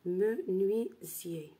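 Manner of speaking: Menuisier said very slowly, syllable by syllable; slow, careful Menuisier for pupils; slow demo word. Shown as a demonstration. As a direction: draw out Menuisier for the class. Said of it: "je vais expliquer comment obtenir ce mot-là".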